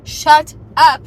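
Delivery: loud burst of disbelief; stunned shout. Retold or imitated. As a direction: blurt it out, loudly amazed. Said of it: "And I was like".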